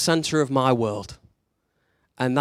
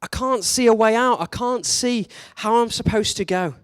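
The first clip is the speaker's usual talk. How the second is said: despairing inner cry; 8 semitones higher than usual. Retold or imitated. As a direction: voice the despair of feeling lost. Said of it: "and we think".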